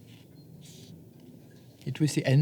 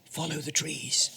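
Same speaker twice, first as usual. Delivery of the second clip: softer, hushed; whisper; 7 dB quieter than usual. Acted. Moose whispering to mouse, hushed. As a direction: keep it down to a hush.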